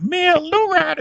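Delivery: squeaky falsetto echo of the question; mock kid reply. Mocking a movie kid's reply in a high voice.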